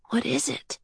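hushed whisper. A narrator's reading. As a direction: ask it softly, half whispering. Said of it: "she whispered".

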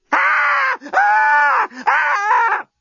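piercing high-pitched screaming; high screams. A narrator's reading. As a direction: shriek in terror. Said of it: "screamed Lucy".

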